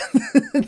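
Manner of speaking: real high laugh. Real, not acted. Laughs at bad scared face.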